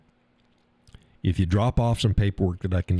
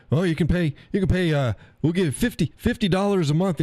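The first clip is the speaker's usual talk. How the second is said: mock salesman; 9 semitones higher than usual. Pushy salesman quoted.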